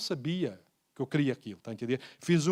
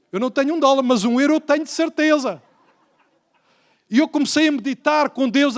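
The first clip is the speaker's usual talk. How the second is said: preaching at full force; 7 semitones higher, 8 dB louder than usual. Real, not acted. Preacher rising to a climax.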